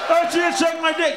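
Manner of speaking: ordinary talk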